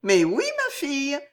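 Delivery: high surprised mistress; taken aback, lightly. Acted. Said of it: "étonnée de la question".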